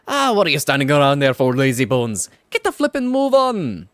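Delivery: loud, rising Welsh shout at a slacker; angry Welsh yell. Acted. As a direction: bark at the lazybones in Welsh. Drippy yells at Oliver.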